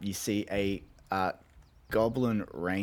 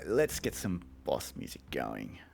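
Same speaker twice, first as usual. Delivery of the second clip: ordinary talk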